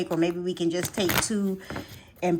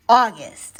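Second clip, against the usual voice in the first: loud announcement; 8 dB louder than usual. Real, not acted. Announcing the next month.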